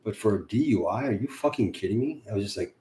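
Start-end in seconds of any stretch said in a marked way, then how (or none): none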